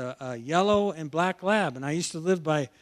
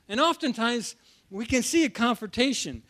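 normal talking voice

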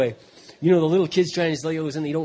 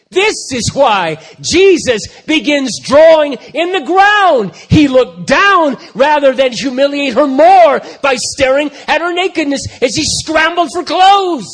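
much higher, loud, slow preached climax; slow, soaring shout of a sermon; full-force preaching; 10 semitones higher, 15 dB louder, slower than usual. Real, not acted. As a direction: drive the point home from the pulpit.

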